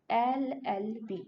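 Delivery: "एल एल बी" spelled out slowly, each letter starting with "e". Shown as a demonstration. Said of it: "एल को pronounce किया".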